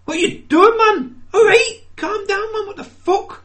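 high, loud broad Geordie rant; exasperated Geordie shout, pitched right up. Acted. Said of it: "like, broad, like, Geordie".

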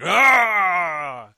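loud, high "Ah!" jump-scare yell; haunted house actor's sudden yell; mock scare shout. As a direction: leap out and roar at the visitor. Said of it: "they come up and they're like".

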